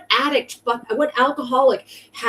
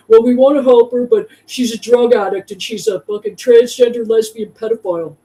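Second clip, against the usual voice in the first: mocking imitation of Lars; 5 dB louder than usual. Mimics Lars badmouthing her to people.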